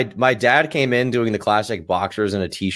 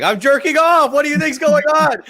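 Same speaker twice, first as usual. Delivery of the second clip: loud, high-pitched indignant yell; acted-out teenage outrage, voice way up; pissed-off shouting; 7 dB louder than usual. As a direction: blurt it out at full exasperated volume. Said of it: "I was so pissed off".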